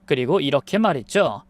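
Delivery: ordinary delivery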